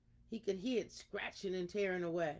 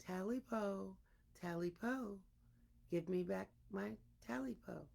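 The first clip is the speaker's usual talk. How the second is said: softly spoken, much softer; quiet version; 8 dB quieter than usual. Shown as a demonstration. Storyteller showing the ghost's call told another way, soft and hushed. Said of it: "It's all in the matter of your voice".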